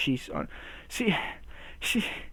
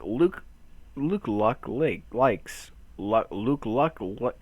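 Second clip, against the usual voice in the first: slow, deliberate word by word; slow, careful twister; 3 semitones lower, much slower than usual. Pretend. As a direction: pick your way through each word. Picking carefully through a tongue twister.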